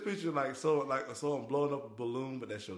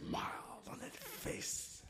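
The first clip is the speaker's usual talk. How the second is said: high, slow airy Joker rasp; 6 dB quieter, much slower than usual. Retold or imitated. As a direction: taunt like the Joker. Joker quote continued.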